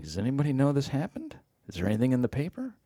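soft, cautious questions; hushed nervous checking; furtive wondering. Retold or imitated. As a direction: feel it out quietly, afraid to be noticed.

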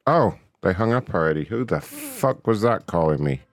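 ordinary talk

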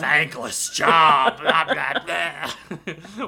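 very loud, slow, craggly villain roar; Nathan's craggly evil voice. Retold or imitated. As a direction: go craggly and evil, badly in need of a lozenge. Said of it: "craggly when he's, like, in evil".

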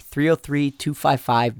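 ordinary delivery

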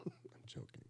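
breathy whispered take-back. Real, not acted. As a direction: whisper it to soften the joke. Whispered take-back after insult.

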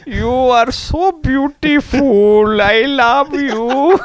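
put-on very slow, high Indian accent; high, syrupy impression of a creepy guru; mock wooing. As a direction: croon it drawn-out in a hammed-up Indian accent. Mock Bikram hitting on women.

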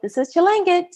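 high and careful, offering the name; hopeful name reveal. Retold or imitated. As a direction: say your Kenyan name hoping he remembers. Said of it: "And I was like".

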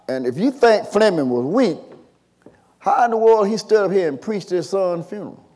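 ordinary delivery

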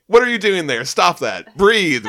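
high, loud urgent orders; exclaimed, bossy concern; alarmed command. Acted. Surfer orders Dawn to breathe.

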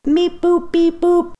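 high, loud robotic beeps; toy robot voice. Pretend. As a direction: beep like a little robot. Playing the mystery sound contest.

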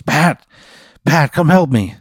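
high, urgent call for help; freaking out. Acted. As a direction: call a friend over in panic. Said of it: "Jim Gums starts freaking out".

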